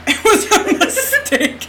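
high, slow laughing repeat. Real, not acted. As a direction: laugh through the words. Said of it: "repeat said laughing".

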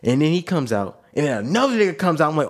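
usual speaking voice

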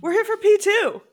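loud, high title announcement; hyped announcement. Pretend. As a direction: call it out high and strong. Answering the cue, announcing film.

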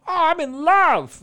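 very high, loud, slow swooning gush; hammed-up lovestruck. Mocking himself falling in love.

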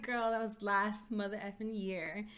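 slow, rising loud disbelief; mock outraged "are you serious"; exasperated retort. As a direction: drag it out, incredulous and fed up.

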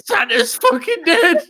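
high shrieking yell; kids screaming. Pretend. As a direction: scream it out like a shocked kid. Kids finding body, screaming.

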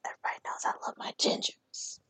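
quick playful whisper. Acted. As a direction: whisper it as a confession. Confides fondness in a whisper.

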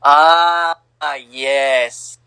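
usual speaking voice

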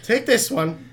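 high little rock creature voice; rock guy voice. Acted. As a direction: pitch up as the small rock guy. Rock guy hands the ball.

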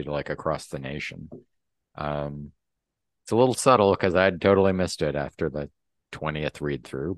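normal talking voice

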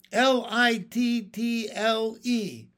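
slow, high, letter by letter; exasperated, deliberate spelling. A narrator's reading. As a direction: spell it pointedly for a slow pupil. Spells the word for him.

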